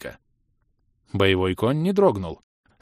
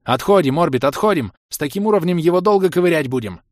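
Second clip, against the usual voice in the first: громко, высоко кричать товарищу; срочно командовать отступление в бою; крик команды; 6 semitones higher, 5 dB louder than usual. A narrator's reading. Командует отступление посреди боя. (прокричать напарнику приказ отходить)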